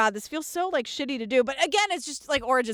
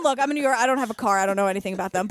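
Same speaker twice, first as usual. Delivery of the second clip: usual speaking voice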